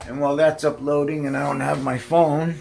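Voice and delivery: normal talking voice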